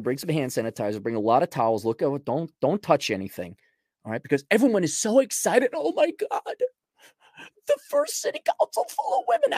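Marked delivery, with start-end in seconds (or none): 5.7-9.5 much higher, breathless fake excitement; mocking gushing voice. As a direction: squeal it like a giddy fan.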